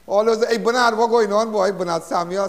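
loud shout-out. Real, not acted. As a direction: call out to Bernard like across a room. Calling out greetings to friends.

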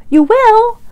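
ordinary delivery

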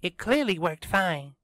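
put-on high, brisk girl character voice; Kiara arguing, insistent. A narrator's reading. Kiara disputes the robot's ending with Tristan, high insistent voice.

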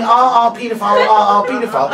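loud, rhythmic mock yelling; mock screaming. Drunk woman running the length of the store, mock screaming. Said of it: "runs the entire length of the store screaming".

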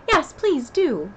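high, loud, drawn-out begging; eager collective plea; chorused plea. A narrator's reading. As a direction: urge it slowly like a whole group. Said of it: "the others all joined in chorus".

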